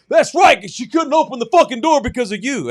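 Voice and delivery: high, very loud angry accusation; screamed comeback. Retold or imitated. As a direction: imitate an enraged stranger yelling back. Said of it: "this weird guy who screamed at me".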